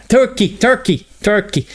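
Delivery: high-pitched Irish accent impression; mock Irish accent. Shown as a demonstration. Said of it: "That's Irish, yeah".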